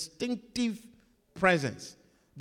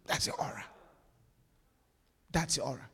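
hushed whisper; 3 semitones lower, 8 dB quieter, faster than usual. Acted. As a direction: whisper it, unvoiced and low. Preacher drops to hush.

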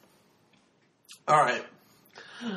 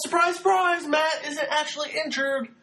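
high, laughing mock surprise; laughing sarcasm; 9 semitones higher than usual. Real, not acted. Sarcastic reveal of fake injury.

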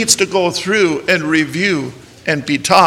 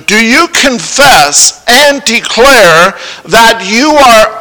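solemn vow reading; 4 semitones higher, 6 dB louder than usual. Acted. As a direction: intone the vow like a rite. Reads vow to bridegroom.

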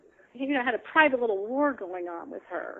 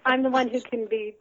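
usual speaking voice

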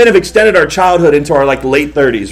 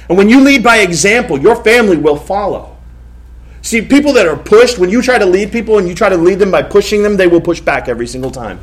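fired-up preaching; 3 semitones higher than usual. Real, not acted. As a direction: preach it out, all pastorally and excited. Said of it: "Get all pastorally and excited".

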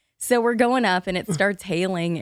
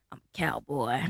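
put-on lower, proud toddler cowboy boast; swaggering little cowboy; 3 semitones lower, 4 dB louder than usual. Three-year-old Eli in new boots, lower proud swagger. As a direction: strut it like a tiny cowboy. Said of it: "He's got some sort of swagger".

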